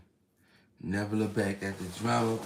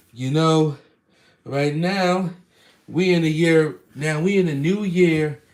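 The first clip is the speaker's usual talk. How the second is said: high, loud and fast; excited; 6 semitones higher, 6 dB louder than usual. Real, not acted. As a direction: hype up the viewers. Excited address to viewers.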